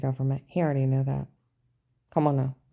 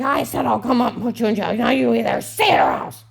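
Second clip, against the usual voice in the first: higher, louder imitated threat; agent's threat; 7 semitones higher, 7 dB louder than usual. Agent forcing her to say it, high loud mock menace. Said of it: "He's like".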